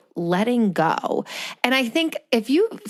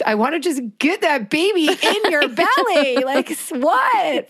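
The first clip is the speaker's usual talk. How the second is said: higher, near-tears wish for a friend; tearful outburst; 7 semitones higher than usual. Real, not acted. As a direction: voice lifting as tears come. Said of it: "Now you're making me cry over here".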